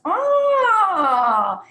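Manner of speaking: high, loud falling ah; face-stretch warm-up ah. Shown as a demonstration. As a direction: open wide and let it fall. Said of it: "making funny faces".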